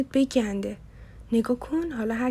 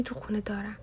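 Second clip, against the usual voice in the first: quiet aside; 7 dB quieter than usual. A narrator's reading.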